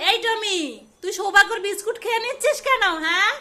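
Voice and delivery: loud stern telling-off; scolding the dog. Pretend. Mother scolds Tommy the dog for eating biscuits, loud and stern. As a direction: tell off the greedy dog.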